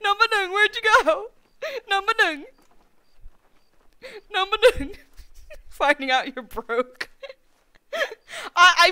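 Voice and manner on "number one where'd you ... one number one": higher, half-laughing whimpering call for the lost horse; mock tearful calling for the missing horse; mock crying call. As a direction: cry out for your horse like a lost pet.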